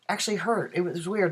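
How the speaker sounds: normal talking voice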